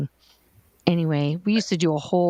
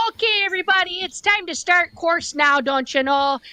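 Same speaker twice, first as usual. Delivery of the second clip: put-on Midwest accent; 11 semitones higher than usual. Starting course for the room, stuck in a Midwest accent. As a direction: announce it like a cheerful Midwest host. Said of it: "I couldn't drop the accent".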